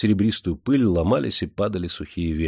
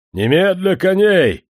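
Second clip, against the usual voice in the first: high-pitched, loud, drawn-out command; hoarse-bass courier's shout; 9 semitones higher, 7 dB louder, slower than usual. A narrator's reading. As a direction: bellow the order slowly and loudly. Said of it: "раздался его простуженный бас". (простуженный бас, громкий приказ)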